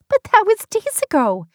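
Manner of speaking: high, loud, shocked protest; gasping disbelief. Acted. Beth learns the proposal is old news, gasping in disbelief. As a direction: protest in scandalised surprise. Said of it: "Beth gasped".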